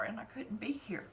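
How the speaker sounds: whispered through tears; choked whisper. Real, not acted. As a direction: let the voice crack into a whisper. Breaking whisper.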